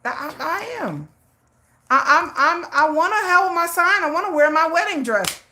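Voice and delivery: high, whiny pleading about the wedding dress; pleading self. Retold or imitated. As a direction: beg like a kid who wants her way. Pleading to friend Jennifer.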